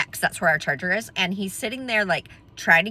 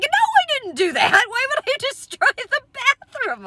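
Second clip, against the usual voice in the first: indignant denial. Retold or imitated. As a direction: imitate an outraged husband, squealing upward. Husband's indignant denial, retold.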